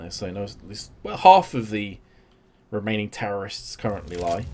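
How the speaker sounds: energetic esports caster calling the action; live play-by-play. Acted. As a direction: call every duel as it happens.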